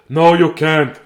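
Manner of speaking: loud grandpa refusal; stern grandpa. Acted. Grandpa forbidding the child to water, stern. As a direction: bark it out as grandpa.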